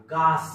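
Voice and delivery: गास with a long open "aa"; wrong reading. Shown as a demonstration. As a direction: read गास with "aa" as in father. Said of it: "असा शब्द होईल का?".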